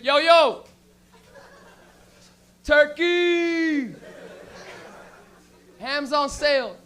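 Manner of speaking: loud, high vendor yell; hollered sales call. Acted. Stoned meat department worker at work, loud hollering. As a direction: holler across the store, stretching the words.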